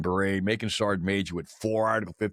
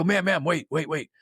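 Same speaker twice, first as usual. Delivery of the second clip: high, hurried mock pleading; retold urgent plea; 8 semitones higher than usual. Caller begging the sergeant's wife to wait, retold urgency. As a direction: stop them hanging up, re-enacted in a high voice. Stopping her from hanging up.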